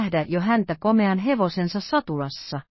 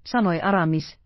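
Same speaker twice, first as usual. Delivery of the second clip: usual speaking voice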